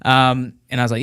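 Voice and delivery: ordinary delivery, nothing marked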